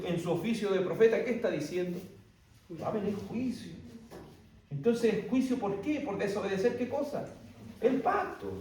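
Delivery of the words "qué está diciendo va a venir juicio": normal talking voice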